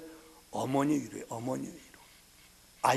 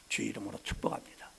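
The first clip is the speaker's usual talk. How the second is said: whispered; 5 semitones lower, 8 dB quieter, faster than usual. Real, not acted. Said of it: "unvoiced whisper".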